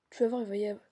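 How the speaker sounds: ordinary talk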